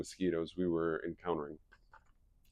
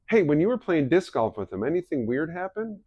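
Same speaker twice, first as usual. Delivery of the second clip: higher probing question; wife probing Drew, higher suspicious voice; 9 semitones higher, 5 dB louder than usual. Retold or imitated. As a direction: fish for details.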